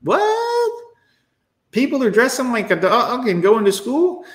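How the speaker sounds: high, rising disbelief; baffled foreigner. Retold or imitated. Foreign friends reacting to American trends, high disbelief. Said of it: "are like".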